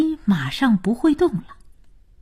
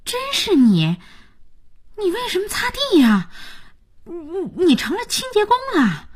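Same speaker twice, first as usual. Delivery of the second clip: high-pitched, incredulous questions; surprised; 8 semitones higher than usual. Acted. Jiayin finds Liao Yu mopping the floor, astonished. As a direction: stumble into disbelief, voice jumping up. Runs into him mopping, taken aback.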